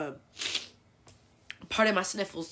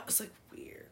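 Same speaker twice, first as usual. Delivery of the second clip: whisper; 17 dB quieter than usual. Real, not acted. Confiding aside.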